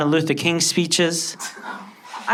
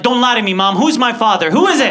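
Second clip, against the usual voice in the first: high, loud accusing questions; demanding; 7 semitones higher, 5 dB louder than usual. Retold or imitated. Son demanding his mother tell him who his father is, high and loud. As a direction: demand the truth from her.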